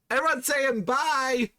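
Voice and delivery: high, drawn-out goodbye call; sing-song send-off. Pretend. Streamer rallying viewers to say bye, high slow call. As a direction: stretch it out high for the crowd. Sending Brett off.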